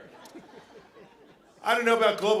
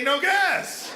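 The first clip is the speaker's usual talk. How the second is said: loud, high, firm No gas; emphatic refusal; 10 semitones higher, 5 dB louder than usual. Retold or imitated. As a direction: bark it out nervously. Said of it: "I say".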